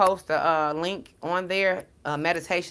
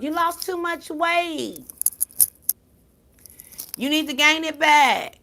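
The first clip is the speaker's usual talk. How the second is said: mocking voice; 9 semitones higher, 4 dB louder, slower than usual. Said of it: "I didn't even tell you".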